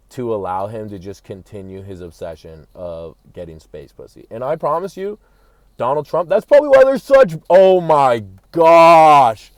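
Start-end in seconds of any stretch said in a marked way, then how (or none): 4.3-9.5 rising to a loud, high, excited outburst; a sudden idea hits, shout it out; excited realization. Real, not acted.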